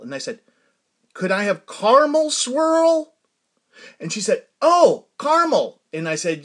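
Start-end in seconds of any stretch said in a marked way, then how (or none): 1.1-3.1 high, loud, slow careful request; mock ordering into a drive-thru speaker; calling out an order. 4.6-5.8 high, loud, drawn-out "oh" of understanding; sudden realization. Retold or imitated. Server finally understands the order.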